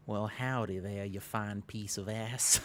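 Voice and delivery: slow, drawn-out Southern drawl, lightly done; a hint of a Southern gentleman, slow and deliberate; Southern accent. Streamer tries a Southern accent on chat, slow drawl. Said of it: "my lip curled to do the Southern accent".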